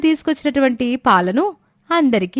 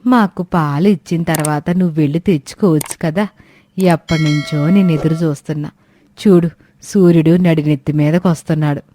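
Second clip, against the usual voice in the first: put-on lower-pitched old woman, complaining; old woman Suramma; 7 semitones lower than usual. Old woman scolding the late milk seller, lower grumbling voice. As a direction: grumble like an impatient old granny.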